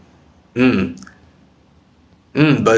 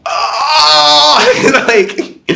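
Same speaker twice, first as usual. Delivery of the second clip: high burst of laughter on oh; laughing out loud; real laugh; 3 dB louder than usual. Real, not acted. Bursts out laughing mid-rant.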